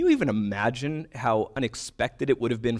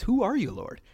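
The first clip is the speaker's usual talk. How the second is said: startled question; 10 semitones higher than usual. Retold or imitated. Voicing Saul's startled question.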